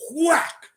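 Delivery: high sharp "whack" sound effect; mock whack sound. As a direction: smack out the word "whack" like a club hitting. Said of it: "and he goes".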